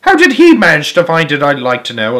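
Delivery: loud, resentful demand; angry shout. A narrator's reading. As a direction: shout it with a grudge. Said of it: "a large boy shouted angrily".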